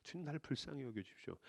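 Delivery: soft, low, hushed prayer; quiet prayer. Shown as a demonstration. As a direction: pray it gently under your breath. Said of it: "제가 좋아하는 기도입니다".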